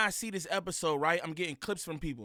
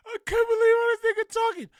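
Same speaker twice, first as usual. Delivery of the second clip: much higher, louder outburst; excited exclaiming; 9 dB louder than usual. Real, not acted. Host shouting his amazement at the guest's talk.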